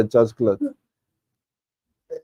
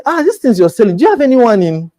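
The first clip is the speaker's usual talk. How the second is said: eager client; 10 semitones higher, 3 dB louder than usual. Retold or imitated. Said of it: "they'll say".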